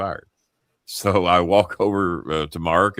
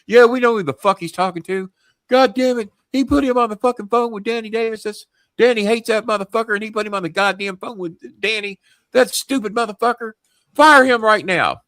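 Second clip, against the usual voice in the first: high, loud ranting boss impression; furious; 14 semitones higher, 5 dB louder than usual. Boss ordering him to fire Mark, furious. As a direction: rage down the phone, building to a loud order. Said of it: "they're fucking furious".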